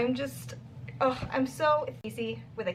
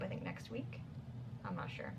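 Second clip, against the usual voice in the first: ordinary talk, nothing marked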